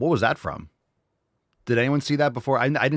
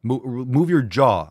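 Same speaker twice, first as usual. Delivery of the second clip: drawn-out, louder furious order; slow angry demand; 5 dB louder, slower than usual. Real, not acted. Pissed viewer demanding the anchor move her jaw, slow anger. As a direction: spell it out slowly in anger. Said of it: "I'm, I'm pissed".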